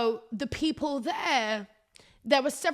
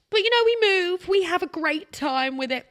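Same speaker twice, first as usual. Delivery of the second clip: much higher and louder, brightly cheering herself on; upbeat rally; 9 semitones higher, 7 dB louder than usual. Real, not acted. To listeners after moaning about her messy house, upbeat and loud. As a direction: shake off the mess with a cheery lift.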